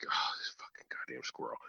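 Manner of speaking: hushed, breathy curse under the breath; whisper. Retold or imitated. Hunter in the woods, cursing a squirrel in a whisper. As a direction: whisper it so the game won't hear.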